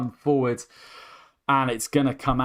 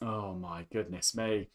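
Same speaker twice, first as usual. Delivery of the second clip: breathless hush; 6 semitones lower, 12 dB quieter than usual. Real, not acted. Exhausted after wild play.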